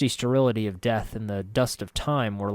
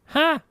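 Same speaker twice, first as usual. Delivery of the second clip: scoff; 6 dB louder than usual. A narrator's reading. Marta scoffs indignantly.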